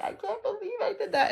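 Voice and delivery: high, breaking voice, half laughing through tears; bawling. Retold or imitated. Retelling to viewers how she sobbed after therapy, a high teary break. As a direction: squeak it out as if the tears are coming back. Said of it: "just bawling my eyes out".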